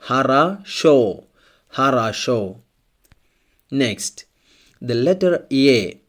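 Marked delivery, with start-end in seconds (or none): 0.0-1.3 slow "Хорошо", ha-ra-SHO, last syllable stressed; unstressed O as A. Shown as a demonstration. Teacher shows learners which O is stressed, slow ha-ra-SHO for "Хорошо". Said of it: "so it's pronounced like a". 1.7-2.6 "хорошо" said ha-ra-SHO; unstressed O as A. Shown as a demonstration. Teacher models an example word for learners, "хорошо" as ha-ra-SHO. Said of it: "so it's pronounced like a".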